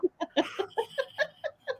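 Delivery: high wheezy laugh; real squeaky laugh. Real, not acted. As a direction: crack up laughing. Laughs at own joke.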